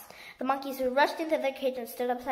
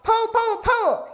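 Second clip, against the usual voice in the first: high, loud repeated yelling; frantic yelled orders; yelling like a demon; 9 semitones higher, 8 dB louder, slower than usual. A narrator's reading. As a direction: bark out each pull like a demon. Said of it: "He was yelling like a demon".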